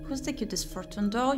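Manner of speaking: ordinary talk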